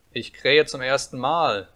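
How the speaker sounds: slow, loud rooster's call. A narrator's reading. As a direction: draw it out, calling from a tower. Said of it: "rief der goldene Hahn hinunter". (gedehnt herunterrufen)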